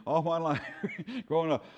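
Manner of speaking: laughing. Real, not acted. Laughing at own joke.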